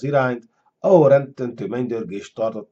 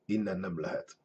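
low, subdued resignation; dragon's huff; 5 semitones lower, 14 dB quieter than usual. A narrator's reading. Caged dragon says escape is impossible, huffing low. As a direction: breathe it out low and softly. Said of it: "fújt egyet a sárkány".

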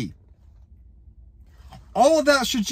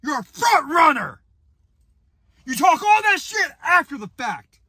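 ranting shout; 7 semitones higher than usual. Real, not acted. Angry rant at coach.

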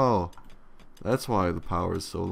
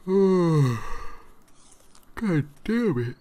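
much higher and louder, pushed through a yawn; yawning curse; 10 semitones higher, 5 dB louder, much slower than usual. Real, not acted. Annoyed streamer cursing mid-yawn, yawning. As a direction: curse while the yawn takes over. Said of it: "I don't know why I'm yawning so much".

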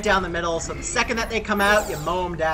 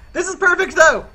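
excited; 9 semitones higher, 8 dB louder than usual. Real, not acted. Gleeful at silly team.

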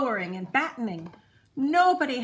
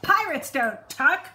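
louder, higher gruff refusal; bellowed; 5 semitones higher, 7 dB louder than usual. A narrator's reading. Braidbeard refusing to tuck the boy in, loud bellow. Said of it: "he bellowed".

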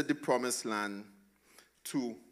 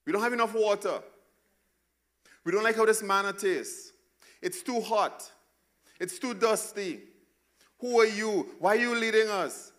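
higher, whiny mock complaining; grumbling Israelites; 9 semitones higher, 5 dB louder than usual. Pastor mimicking the grumbling Israelites, whiny and higher. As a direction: whine like the crowd in the desert. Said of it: "they grumbled against Moses".

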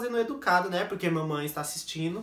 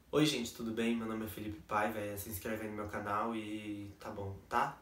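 much softer, lower, timid mumble; shy first video; 8 semitones lower, 10 dB quieter than usual. Retold or imitated. Imitating his shy first video, very soft and low. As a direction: mumble it like a nervous beginner. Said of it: "no primeiro vídeo eu tô tipo assim".

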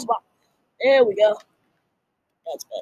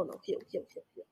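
breathless unvoiced whisper; whispered plea; 19 dB quieter, faster than usual. Real, not acted. Fading plea for heal.

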